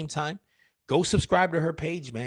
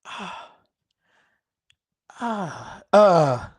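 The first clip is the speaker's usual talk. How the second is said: gagging noise; 5 semitones higher, 4 dB louder, much slower than usual. Shown as a demonstration. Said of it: "I do this".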